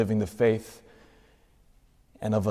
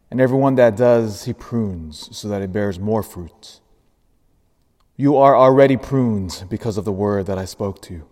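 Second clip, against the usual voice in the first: gospel reading; 2 semitones lower than usual. Acted.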